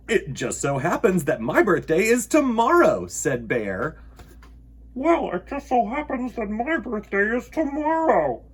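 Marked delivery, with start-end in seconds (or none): 0.1-3.1 loud, cheerful bear voice; pleased little bear; Bear's answer. Acted. 4.9-8.4 echo. Acted. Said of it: "his own voice echoed off".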